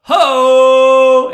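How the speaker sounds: long, high, loud holler; imitated wrestler's yell. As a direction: holler it like a brawler's battle cry. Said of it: "He yells".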